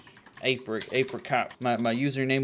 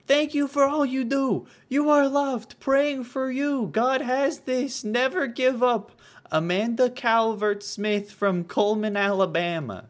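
high, put-on southern accent; mock southern fan-letter voice, starting high and easing down; bad southern accent; 12 semitones higher, 4 dB louder than usual. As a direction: gush it in a hammed-up Southern twang. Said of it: "My southern accent is just, uh, fucking Dale Gribble".

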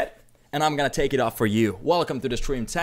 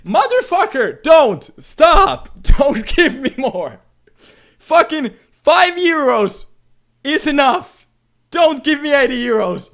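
real yelling, high and loud; shouted protest; 10 semitones higher, 7 dB louder than usual. Real, not acted. Streamer begging a donor to stop giving, shouting. Protesting another large donation.

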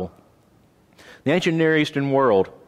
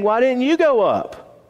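mocking taunt; 10 semitones higher than usual. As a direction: taunt him like mocking lads, voice pitched up. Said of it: "So they're saying".

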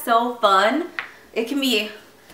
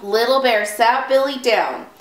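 ordinary delivery, nothing marked

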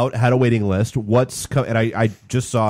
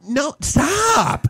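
high-pitched mock outcry; retold protest. Reacting to nieces' endless selfies, high mock protest. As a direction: cry it out, pitched way up.

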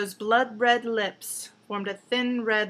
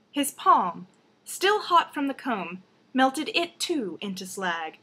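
plain reading voice

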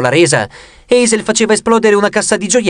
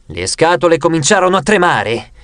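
ordinary delivery, nothing marked

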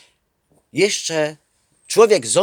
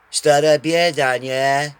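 mock weary sigh; 5 dB louder, slower than usual. Weary guy in sauna quoted.

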